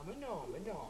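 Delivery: very soft, placating denial; hushed appeasing murmur; meek backing down. Acted. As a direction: retreat at once, barely audible. Backs down before his wife.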